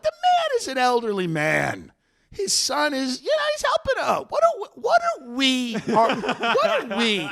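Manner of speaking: high-pitched, mock-outraged defence; indignant protest. Loan officer defending the son to the closing attorney, acted indignation. Teller acts out defending the borrowers.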